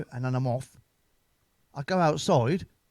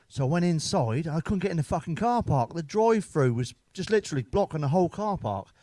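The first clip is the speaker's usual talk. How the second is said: ordinary delivery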